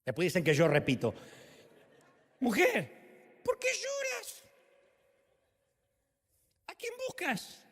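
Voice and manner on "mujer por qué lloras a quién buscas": high, slow, soft and tender question; tender Jesus. Acted. Jesus tenderly asks grieving Mary why she weeps, high and soft. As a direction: coax her gently, almost a hush.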